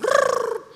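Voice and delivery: loud, high trilled rrrr; imitated phone noise. (tiruan bunyi telepon, nyaring)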